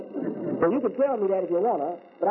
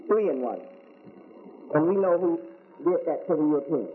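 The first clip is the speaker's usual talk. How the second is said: usual speaking voice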